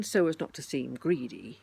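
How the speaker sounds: ordinary delivery, nothing marked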